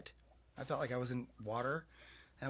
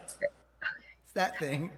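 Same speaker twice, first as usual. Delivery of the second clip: ordinary talk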